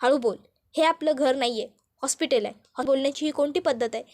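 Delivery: plain reading voice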